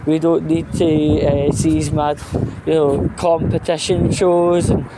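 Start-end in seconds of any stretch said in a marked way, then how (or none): none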